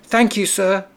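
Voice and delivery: high, small thankful voice; grateful little mouse. A narrator's reading. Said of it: "replied the mouse".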